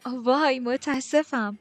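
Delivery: loud, high, flustered delight; thrilled. A narrator's reading. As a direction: burst out with an excited introduction. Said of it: "ماری به وجد اومد".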